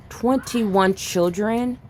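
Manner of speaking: TWENTY-ONE drawn out slowly, as a stunned question; stunned disbelief. Real, not acted. Stunned repeat of the reported figure.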